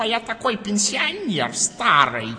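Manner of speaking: put-on slow, high old man's voice. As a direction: drag it out like a frail pensioner. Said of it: "Он вот таким вот голосом говорит".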